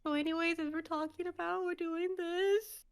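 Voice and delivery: high, soft, strained little voice; squeaky cover-up. Retold or imitated. Coworker carrying on after a voice crack, high soft squeak. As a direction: carry on in a small squeak as if nothing happened.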